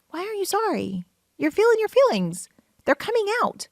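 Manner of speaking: high-pitched, exasperated protest; incredulous reassurance. Retold or imitated. Answers a crier's apology.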